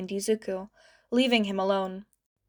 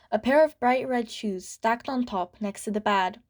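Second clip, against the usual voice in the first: ordinary delivery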